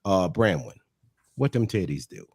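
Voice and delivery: low, smooth mock come-on; mock seduction. Imagined husband sweet-talks Branwen at the hotel, low mock seduction. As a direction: drop low like a lazy pickup line.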